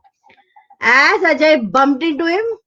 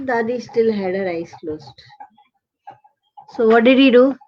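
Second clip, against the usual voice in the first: usual speaking voice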